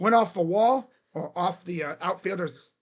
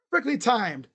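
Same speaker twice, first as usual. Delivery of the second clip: ordinary talk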